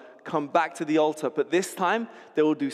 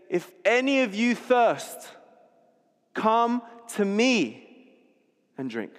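crying out; 6 semitones higher, slower than usual. Retold or imitated. Preacher voices Jesus calling over the festival, mild cry. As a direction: lift the invitation over an imagined crowd. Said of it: "crying out over and above the trumpets".

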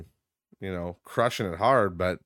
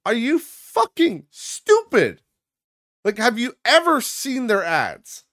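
exasperated; 13 semitones higher, 5 dB louder, slower than usual. Retold or imitated. Ranting at people buying from Temu.

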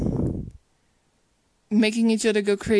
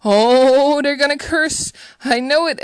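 higher, louder panicked producer; freaking out; 5 semitones higher, 9 dB louder than usual. Retold or imitated. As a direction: imitate the producer losing it. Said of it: "he freaks out".

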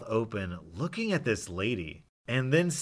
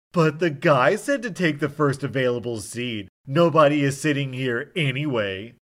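loud, higher, angry protest; arguing; 3 semitones higher, 7 dB louder than usual. Retold or imitated. Drunk passenger arguing with the flight attendant, loud and angry. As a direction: push back hard and loudly.

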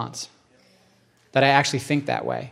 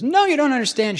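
put-on tearful kid; 11 semitones higher than usual. Weeping kid voiced in story.

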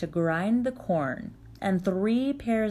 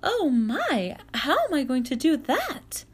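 put-on young girl voice; 8 semitones higher, 3 dB louder than usual. Vasilisa dismayed.

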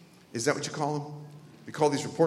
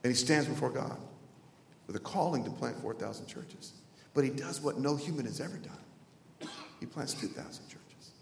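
suddenly soft and lower; hushed gravity; 3 semitones lower, 10 dB quieter than usual. Real, not acted. Preacher describing a man standing before God, hushed and grave. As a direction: let it sink almost to a murmur.

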